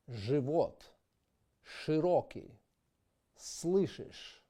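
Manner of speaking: живот, широкий, слышишь with a ы vowel; Russian ы after consonants. Shown as a demonstration. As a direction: demo ы after ж and ш. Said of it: "the letter I is pronounced like I".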